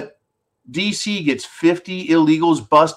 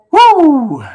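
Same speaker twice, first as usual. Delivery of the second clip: high, loud "woo"; excited whoop; 13 semitones higher, 10 dB louder than usual. Real, not acted. Host kicks off the viewer questions, excited high whoop. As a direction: let out a big ringing whoop.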